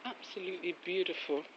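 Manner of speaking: ordinary delivery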